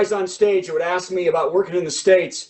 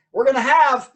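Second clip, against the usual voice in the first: announcer introduction; 5 semitones higher than usual. Real, not acted. Host bringing on his comedian friend, announcer voice. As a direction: build up the next act like an emcee.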